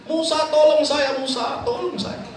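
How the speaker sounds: high, slow pleading cry for help; desperate plea to Musa. Acted. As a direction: beg Musa to save you as the earth swallows you. Korun begs while sinking.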